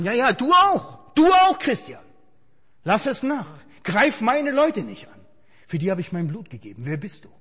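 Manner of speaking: Jesus rebuking him. Acted. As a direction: scold him as the Lord defending his people. Preacher voices Jesus rebuking him.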